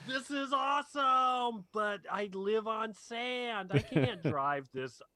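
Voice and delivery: excited shout. Acted. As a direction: shout it like a stoked dude. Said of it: "And he's like".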